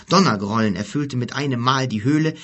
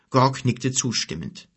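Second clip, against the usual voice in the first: ordinary delivery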